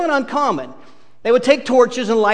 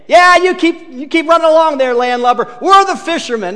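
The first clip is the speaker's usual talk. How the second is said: higher, louder mock scoff; sarcastic retort; 8 semitones higher, 7 dB louder than usual. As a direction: brush him off with sarcasm. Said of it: "I know what I'd be saying".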